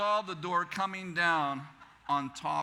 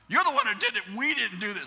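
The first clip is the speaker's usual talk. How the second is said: high, loud mock protest; imitating indignant girls; indignant accusing; 4 semitones higher, 7 dB louder than usual. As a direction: throw the blame back loudly. Girls blame him, acted quarrel.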